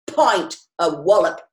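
put-on pushy old drinker demanding; aggressive demand. A narrator's reading. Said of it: "he added aggressively to the barman".

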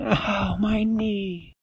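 high hurt cry; high mock pain. A narrator's reading. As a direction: yelp it as if you scraped a knee. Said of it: "She went".

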